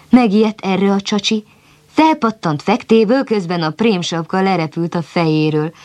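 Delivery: ordinary delivery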